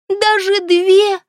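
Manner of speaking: very slow, high, mannered question; affected drawl. A narrator's reading. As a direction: stretch it out with mock surprise. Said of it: "манерно протянула она". (манерно протянуть)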